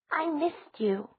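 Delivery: put-on high sweet child; small child hugging; little girl voice. A narrator's reading. Four-year-old hugging Elena.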